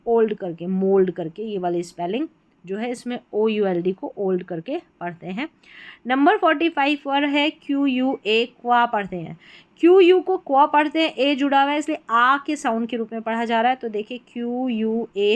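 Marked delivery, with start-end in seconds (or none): none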